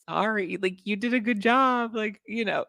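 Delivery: very high, sing-song consolation; high, sweet, mock-comforting praise; mock consoling. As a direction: pat him on the back in a high voice. Consoling Josh after rejection.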